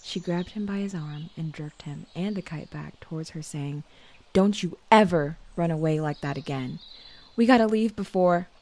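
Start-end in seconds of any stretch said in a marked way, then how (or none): 4.3-8.5 loud, urgent telling-off; scolding. A narrator's reading. Sister jerks her runaway brother back, scolding loudly. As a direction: scold him hard, punching the words.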